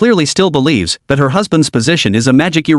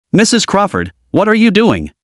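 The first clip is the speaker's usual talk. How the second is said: higher, cutting rebuke; sharp; 5 semitones higher than usual. Acted. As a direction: stop her dead, sharply. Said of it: "Judge Caprio's voice slices through the room sharp".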